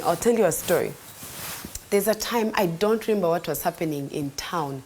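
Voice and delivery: ordinary talk, nothing marked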